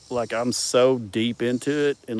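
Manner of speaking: ordinary talk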